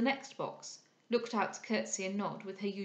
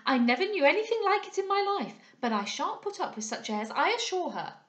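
high, louder, spirited outburst; spirited indignation; 8 semitones higher, 6 dB louder than usual. A narrator's reading. Young lady haranguing a gentleman with no little spirit. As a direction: voice a young lady's lively protest, pitched up. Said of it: "with no little spirit she did".

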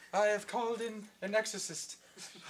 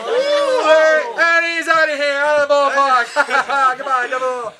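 loud, excited home run call; 1940s baseball announcer; 14 dB louder than usual. Acted. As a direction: yell the home run to the crowd. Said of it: "nineteen forties baseball announcers".

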